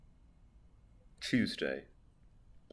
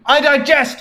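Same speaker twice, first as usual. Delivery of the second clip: character quote; 8 semitones higher, 4 dB louder than usual. Acted.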